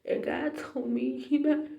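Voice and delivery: high, tearful voice, overcome; real crying. Real, not acted. Tells viewers what God told her, crying. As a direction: choke up as the words come. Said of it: "I'm crying like a big baby".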